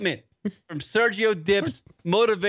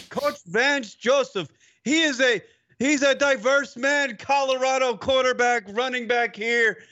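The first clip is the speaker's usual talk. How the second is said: mock sideline reporter; 6 semitones higher than usual. Imitating a sideline reporter's spiel.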